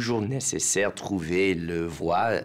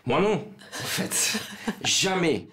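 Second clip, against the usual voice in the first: laughing; 10 semitones higher than usual. Real, not acted. Laughs while denying he fell.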